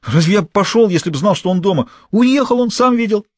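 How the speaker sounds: a scared small-time crook blurting it out; a cornered accomplice answering the gang boss, high and nervous; frightened petty thief. A narrator's reading.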